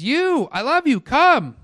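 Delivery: high, commanding royal summons; a king beckoning his people; summoning king. Acted. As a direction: call them over like a king. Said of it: "like a king summons his people".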